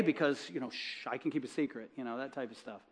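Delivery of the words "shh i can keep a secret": soft playful shush; mock hushed secret. Man teasing her about being a spy, in a soft hush.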